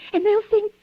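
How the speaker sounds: usual speaking voice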